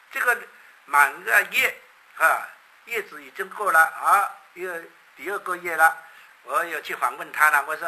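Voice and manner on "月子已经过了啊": ordinary delivery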